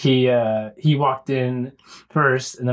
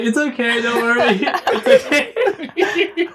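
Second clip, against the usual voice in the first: giggly high reassurance; laughing reassurance; 9 semitones higher than usual. Retold or imitated. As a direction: comfort him while laughing. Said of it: "I was like".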